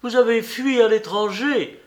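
outraged exclamation; exclaiming. A narrator's reading. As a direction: fling the accusation at him. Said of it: "s'écria madame Boulanger".

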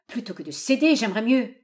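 exasperated refusal; angry outcry. A narrator's reading. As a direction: cry out in an old father's exasperation. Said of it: "cédait de nouveau à la colère".

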